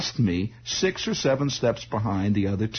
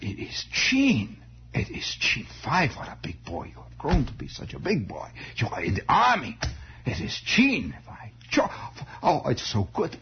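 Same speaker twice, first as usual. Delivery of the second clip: put-on vague German accent; 4 semitones higher, 3 dB quieter than usual. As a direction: greet him haltingly in a German accent. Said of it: "they all had this vague German accent".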